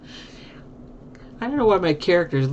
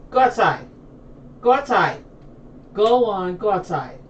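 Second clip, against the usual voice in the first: firm, high-pitched command to a dog; calling out; 6 semitones higher, 4 dB louder than usual. Real, not acted. Owner ordering a barking dog outside, calling out. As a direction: send the dog out in a raised, higher voice.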